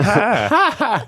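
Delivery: high Southern drawl stretching hi into many A's; Southern belle greeting, vowel stretched long; drawn-out Southern hi. Shown as a demonstration. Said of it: "never heard that many A's in the word hi".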